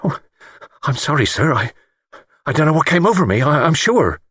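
high, halting apology; frightened. A narrator's reading. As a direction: falter through a frightened apology. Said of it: "He looked frightened".

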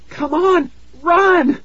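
very high, piercing urging; urgent scream. A narrator's reading. Tara shoves her parents to flee, screaming. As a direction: scream to get them moving. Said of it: "Tara screamed".

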